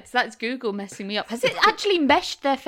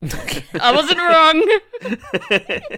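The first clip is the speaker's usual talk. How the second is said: loud laughing I wasn't wrong; triumphant loud defence; laughing protest; 4 semitones higher, 10 dB louder, much slower than usual. Real, not acted. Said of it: "There is a John McCartney".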